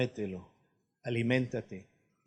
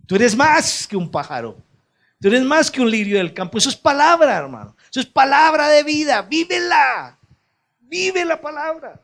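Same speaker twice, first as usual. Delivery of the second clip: high, loud preacher's cry; rising, shouted sermon climax; fiery preaching; 13 semitones higher, 11 dB louder than usual. Acted. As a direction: preach it full-throated to the crowd. Preaching at full force.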